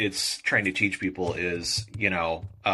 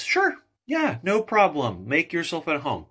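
friendly moose; 9 semitones higher than usual. Acted. Nice moose answers the bug.

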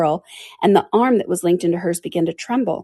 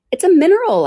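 bright guess; 13 semitones higher, 7 dB louder than usual. Acted. Alice guesses brightly, high.

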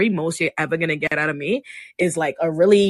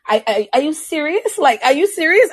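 high, incredulous "are you serious", louder at the end; retold disbelief; 10 semitones higher, 4 dB louder than usual. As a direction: pitch it way up in stunned disbelief. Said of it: "I'm like".

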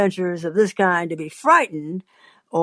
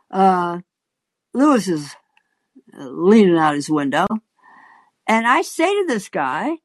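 ordinary delivery

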